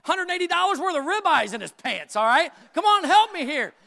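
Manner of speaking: high, loud pleading cop; officer wrestling a shoplifter begs the crowd for help, high and loud. Retold or imitated. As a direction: beg the crowd to pitch in. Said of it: "I'm looking up like".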